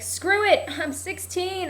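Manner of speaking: mock-reckless teenage bravado, pitched high; defiant teen. Acting out the show's teenage heroine.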